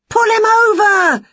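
high, loud, slow shout at the TV. Acted. Witch shouting at the wrestling on telly, loud. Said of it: "shouted Winnie at the telly".

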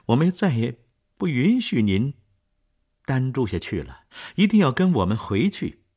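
won't take no; urgent insistence. Acted. Cosette urging her father home, loud pleading.

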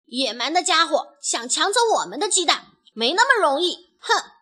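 loud, high indignant outburst; furious. A narrator's reading. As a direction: spit it out in defiance, ending on a huff. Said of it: "愤怒地冲了出去". (愤怒不服地大喊)

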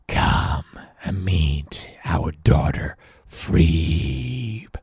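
slow, rasping monster voice; big monster host, rasping slowly; Grit. Acted. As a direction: invite in a growly creature voice, drawn out. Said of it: "says Grit".

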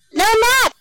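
put-on very high child's protest; little girl refusing. A narrator's reading. Susie answering Mom about her nap, high-pitched refusal. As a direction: pipe up in a small girl's high voice.

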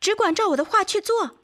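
insistent, urgent instruction; urgent command. A narrator's reading. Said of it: "急迫地下着命令".

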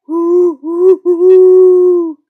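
very loud, high drawn-out wail; slow, swelling ghostly hooting; imitated ghost howl. A narrator's reading. Brother imitating a ghost, loud eerie howl. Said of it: "小熊哥哥学了一声鬼叫".

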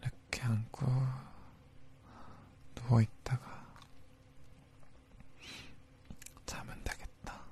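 hushed lulling whisper; soothing whisper. Acted. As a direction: whisper her to sleep. Lulling her to sleep.

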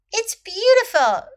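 high, loud exclamation of delight; excited storybook exclamation; delighted cry. A narrator's reading. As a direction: cry it out in admiration. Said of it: "cried Maud".

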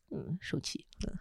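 whispered pout. Acted. Wife sulking at husband, whispered. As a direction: breathe it with a pout.

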